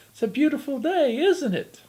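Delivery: high, sweet put-on fairy godmother voice. Devil in disguise greets the grumbling old woman, high fairy godmother voice. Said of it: "says in his best fairy godmother voice".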